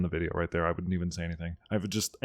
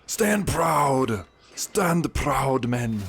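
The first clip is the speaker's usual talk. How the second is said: battle cry; 6 semitones higher, much slower than usual. Pretend. Gamer rallying his troops in a battle, shouting. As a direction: roar at your men to hold the line.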